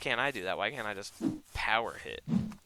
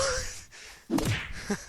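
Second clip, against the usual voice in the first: high unvoiced laugh of disbelief. Real, not acted. Gamer laughing at his own panic, high laugh.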